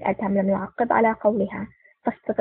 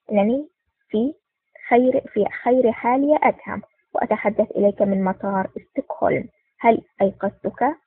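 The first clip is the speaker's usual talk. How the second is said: ordinary delivery, nothing marked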